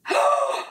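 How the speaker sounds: sharp high gasp. Acted. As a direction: gasp in alarm. Howl in the night.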